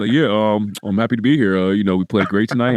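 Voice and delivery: flat post-game interview voice; mock athlete at the mic; press conference athlete. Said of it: "sounded like, like, in a press conference".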